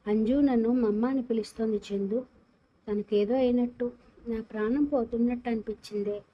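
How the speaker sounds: ordinary delivery, nothing marked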